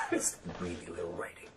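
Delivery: soft, whispered joke; playful little whisper; hushed aside. Pretend. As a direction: whisper it low, as if tiny. Joking about tiny tattoo writing.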